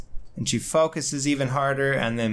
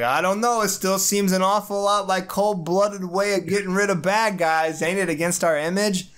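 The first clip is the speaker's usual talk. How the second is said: a hint of a troubled gruff hero; Ben voice; 7 semitones higher than usual. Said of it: "he's like".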